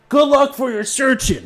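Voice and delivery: high, carried shout across the water; yelling sailor. Acted. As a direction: call out from the rowing boat.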